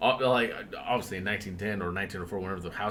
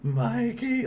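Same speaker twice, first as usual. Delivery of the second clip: trembling call; 6 semitones higher, much slower than usual. Retold or imitated. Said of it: "like that, like, trembling".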